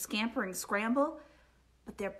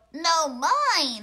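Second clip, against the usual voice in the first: loud, raised-pitch holler; hollered retort; 4 semitones higher, 10 dB louder than usual. A narrator's reading. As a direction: holler right back at a rival. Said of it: "hollered Bruce".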